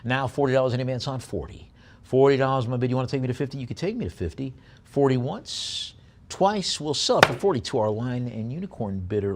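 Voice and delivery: brisk auction chant, pushing for bids; auctioneer bid call. Real, not acted.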